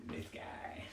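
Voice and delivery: unvoiced whisper to the cat; hushed aside; whispered this guy. Real, not acted. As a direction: whisper it like a secret. Pointing at the cat.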